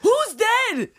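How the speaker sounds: husband completely lost, yelling; confused shout. Retold or imitated. Husband unsure who died, frantic shout.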